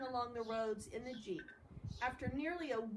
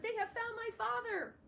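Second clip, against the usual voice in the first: high, excited shout of joy; 10 semitones higher than usual. A narrator's reading. Salva shouting to Chris and Louise, overjoyed. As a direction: cry it out in joyful disbelief. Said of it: "he shouted".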